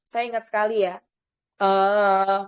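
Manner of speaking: ordinary talk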